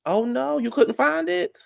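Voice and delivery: very high, slow fake dismay; mock sympathy. Host teasing viewer who searched in vain, mock dismay. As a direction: coo it way up high in pretend concern.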